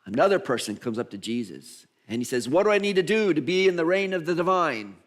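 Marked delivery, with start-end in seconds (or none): 2.5-5.0 lightly acted seeker, louder and higher; eager questioner. Person coming up to Jesus for instruction, eager questioner. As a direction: ask it keenly, raised in pitch and volume.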